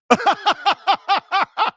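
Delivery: bursting into high laughter; a sudden big laugh; loud laugh. Real, not acted. Host laughs at caller's name.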